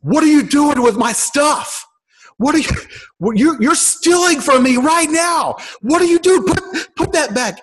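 high, loud furious yelling at a stranger; angry homeowner shouting at the pastor and his friend; irate shout. Retold or imitated. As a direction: bellow it like a man catching a thief. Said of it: "and he shouts".

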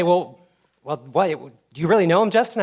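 hammed-up doubting congregant; doubtful listener. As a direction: raise a teasing eyebrow at Justin. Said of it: "you would say".

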